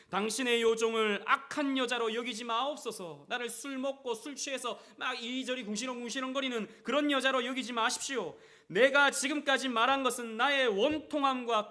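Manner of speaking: high, loud pleading scripture reading; impassioned plea. Acted. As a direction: plead as Hannah, loud and hurt. Reading Hannah's plea loudly.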